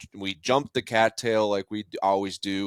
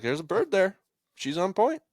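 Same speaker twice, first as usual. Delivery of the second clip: high, insistent mock dad voice; imitating dad; 11 semitones higher than usual. Son mimics his dad insisting a bird is there, high and insistent. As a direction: mimic the stubborn father, pitched way up. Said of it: "my dad's like".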